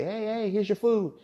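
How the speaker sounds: high, slow sing-song feeding call; mock zookeeper call. Keeper whistling orcas over, calling in a high slow sing-song. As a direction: coax the pet over like a keeper with a bucket.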